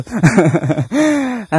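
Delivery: short burst of laughter; high, tickled laugh; amused laugh. Real, not acted. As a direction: laugh out loud at your own joke. Laughs at taming the ghost.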